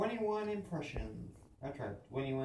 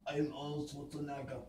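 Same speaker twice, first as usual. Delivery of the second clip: put-on Austrian accent, action-hero style; a light Austrian strongman accent; Arnold Schwarzenegger.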